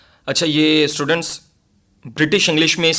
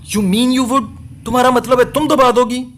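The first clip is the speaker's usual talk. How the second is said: higher, appalled question; appalled disbelief; 9 semitones higher, 3 dB louder than usual. Acted. Arthur stares at his wife, appalled and higher. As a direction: recoil at what she is suggesting. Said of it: "Arthur ने खौफजदा होकर उसे घूरा".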